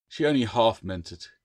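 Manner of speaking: ordinary delivery, nothing marked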